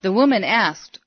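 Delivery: plain reading voice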